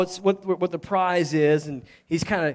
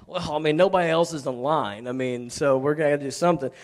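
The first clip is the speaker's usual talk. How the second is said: low, softer, resigned musing; reluctant consent; 6 semitones lower, 4 dB quieter than usual. Acted. Saul consenting as nobody else volunteers, low reluctant mumbling. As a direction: give in reluctantly, voice dropped low. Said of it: "Saul finally consented".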